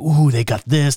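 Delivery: mildly excited ooh over a menu. Retold or imitated. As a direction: retell a little burst of menu excitement. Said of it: "And I'm excited".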